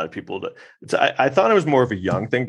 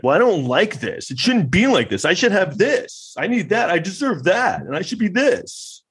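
high, rising whiny complaint; a high-pitched mock-entitled whine; 10 semitones higher than usual. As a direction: whine like a spoiled child.

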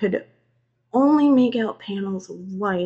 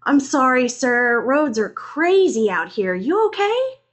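higher, louder call; shouted over rain; 5 semitones higher, 7 dB louder than usual. A narrator's reading. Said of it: "I shouted over the rain".

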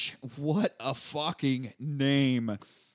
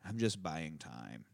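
low murmured aside; 9 semitones lower, 11 dB quieter, slower than usual. Retold or imitated. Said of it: "And he goes".

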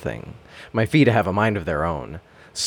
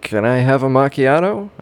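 doubtful; 4 semitones higher, slower than usual. Acted. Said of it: "with a bit of doubt in my voice".

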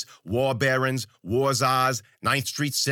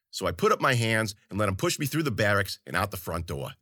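plain reading voice

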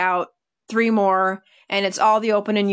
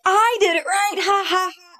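higher, gleeful dog boast; proud dog's voice; 10 semitones higher than usual. Retold or imitated. Handler voicing her dog after good heeling, high and proud. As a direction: crow it for the dog, high-pitched.

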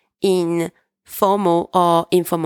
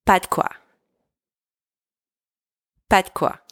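"Pas de quoi" run together quickly as one word; a bit faster; faster than usual. Shown as a demonstration. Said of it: "Saying it a bit faster".

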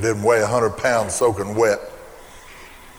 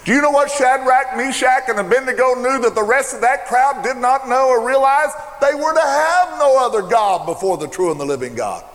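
preaching at full force; 11 semitones higher than usual. Acted. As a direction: preach it out with fire.

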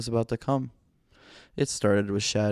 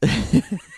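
high laugh; 10 semitones higher, 6 dB louder than usual. Real, not acted. Laughs at own joke.

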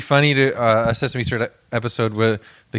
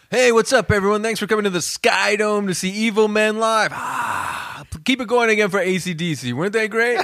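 stage host; 9 semitones higher than usual. Pretend. Mock live stadium show opener.

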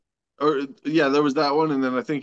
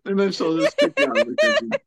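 normal talking voice

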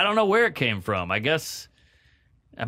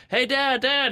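put-on very high, eager little-kid voice; imitated child calling out, pitched way up; seven-year-old kid; 12 semitones higher, slower than usual. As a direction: pester Dad like a small child. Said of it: "you're a seven-year-old going".

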